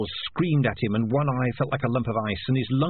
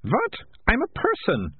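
put-on girl voice; 12 semitones higher than usual. A narrator's reading. Militia speaks.

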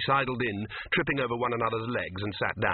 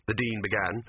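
ordinary delivery

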